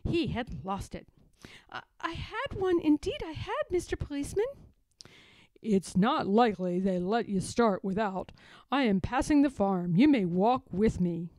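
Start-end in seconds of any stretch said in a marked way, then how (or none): 1.7-4.5 high, timid little pig voice, half whispered at the start; a small pig stammering to a policeman; lightly voiced anxious piglet; nervous little pig. 5.6-11.3 lower, louder policeman voice; a firm constable laying down the law; gruff policeman. Acted. Policeman character speaks.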